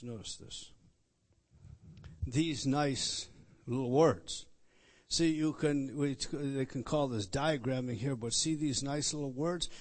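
usual speaking voice

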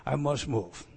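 usual speaking voice

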